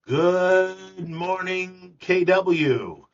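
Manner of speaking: high, loud drawn-out morning greeting; Marty Miller impression. Host opening a video with an impression of a colleague, high loud drawn-out greeting. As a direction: stretch it out like a booming radio wake-up call. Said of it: "Marty Miller impression".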